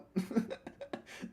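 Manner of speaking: airy squeal of a laugh; high breathy giggle. Real, not acted. Streamer giggles with joy at a good trade.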